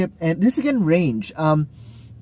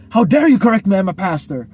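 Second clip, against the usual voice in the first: mock outrage; 3 semitones higher, 4 dB louder than usual. Pastor rejecting correction, hammed-up indignant outburst. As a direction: play the offended pastor, hammed up. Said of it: "it's not like the overly".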